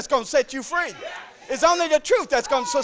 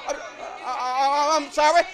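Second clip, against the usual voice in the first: usual speaking voice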